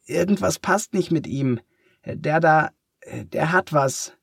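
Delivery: put-on high, cracking boy's cry; voice cracking with excitement. A narrator's reading. Boy calls the teacher, voice cracking with excitement. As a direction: voice breaks over in alarm. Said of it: "Matts Stimme überschlägt sich vor Aufregung".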